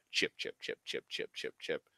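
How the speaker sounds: mock chant whispered under the breath; whispered teasing. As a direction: tease him with a sly whispered chant. Father teasing Jimmy while raking.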